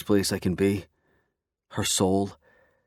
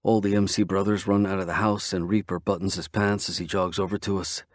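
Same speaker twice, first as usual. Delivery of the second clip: usual speaking voice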